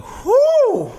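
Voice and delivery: loud, high-pitched whew of lost breath; winded whew. Retold or imitated. Rapper reenacting running out of air mid-verse, winded whew. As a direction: blow out a big exaggerated whew. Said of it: "as I was saying, I was like".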